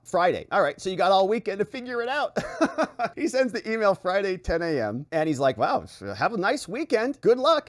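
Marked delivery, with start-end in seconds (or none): 2.4-3.1 short high disbelieving laugh; scoffing laugh. Real, not acted. Commentator laughing at the Friday deadline, high scoff. 5.6-7.7 high, louder fake-friendly sign-off; mock cheery. Mocking the Forbes reporter's email to Don, fake cheer.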